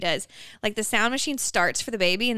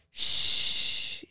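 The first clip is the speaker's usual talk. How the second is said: shushing sound. Retold or imitated. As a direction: shush as if soothing a baby. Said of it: "little shushers that will go shh".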